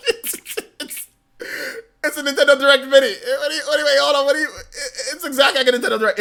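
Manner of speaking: high, laughing in disbelief. Real, not acted. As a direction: crack up while protesting.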